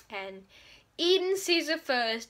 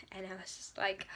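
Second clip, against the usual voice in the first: whispered; 7 semitones lower, 13 dB quieter than usual. Real, not acted. Whispering her reaction to the book.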